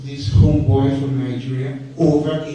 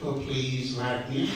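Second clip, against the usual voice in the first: mock pleading; 4 semitones lower, 8 dB quieter, slower than usual. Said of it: "You start to".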